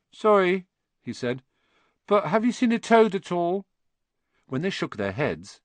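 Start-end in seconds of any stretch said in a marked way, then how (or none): 0.1-0.6 high, louder, plaintive apology; timid boy close to tears; tearful. Acted. Boy interrupting to ask about his lost toad, tearful. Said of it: "He looked tearful". 2.1-3.7 high, plaintive plea; boy anxiously asking for help; tearful. Acted. Boy asking strangers about his lost toad, tearful. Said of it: "He looked tearful".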